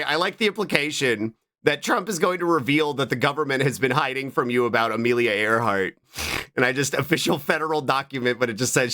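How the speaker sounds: ordinary talk, nothing marked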